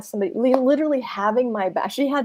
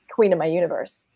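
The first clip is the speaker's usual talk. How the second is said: usual speaking voice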